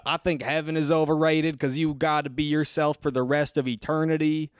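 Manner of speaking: deadpan Southern comic character voice; Southern accent. Acted. Comic doing another joke for the host, Southern accent. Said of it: "if you say that in a regular voice".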